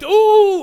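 high loud gleeful ooh; a delighted fanboy ooh; excited ooh. Retold or imitated. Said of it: "He does the sound effect".

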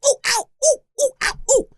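high yelping ooh and ow; hammed-up high-pitched yelps; mock squealing. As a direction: yelp like stepping on tacks. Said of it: "saguaro cactus census taker".

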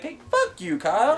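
high-pitched playful cursing; mock outburst. Host imagines the listener snapping at Kyle, mock outburst. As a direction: curse a friend in pretend annoyance.

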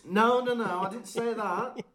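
high, loud protest through a laugh; laughing mock exasperation; exasperated. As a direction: cut him off in mock outrage. Acted quarrel with house-elf.

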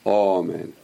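"amen" with a long "oh" first, "oh-mein". Shown as a demonstration. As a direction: stretch the rounded first vowel of "amen".

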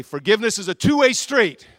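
loud preacher's call to repeat; loud congregational call-out. Shown as a demonstration. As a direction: proclaim it so they echo you.

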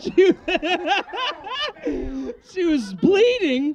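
drawn-out laughing disbelief; slow incredulous laugh; shocked laughing question. Real, not acted.

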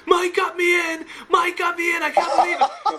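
overjoyed shout. Retold or imitated. James on the phone, shouting his joy at getting in. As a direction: burst out like your dream just came true. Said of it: "I get James on the phone in tears, like, crying with, with happiness".

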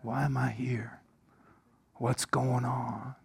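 soft, breathy whispered worry; muttered doubt. Retold or imitated. Churchgoer doubting in the pew, soft and whispered. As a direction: whisper it to yourself.

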